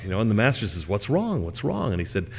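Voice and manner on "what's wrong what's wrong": high, fairly quiet concerned query; concerned questioning. Acted. Master to his terrified servant, concerned questioning. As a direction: ask with worry, pitch lifted and voice held back.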